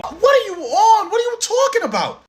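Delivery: hammed-up incredulous squeak; mock disbelief. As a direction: play it like the accusation is absurd. Skit, incredulous reply to accusation.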